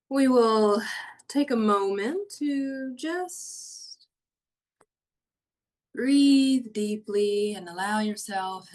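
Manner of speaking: hushed guiding voice for a body-awareness meditation; guided meditation. Acted. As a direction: ease them down into calm, slowly.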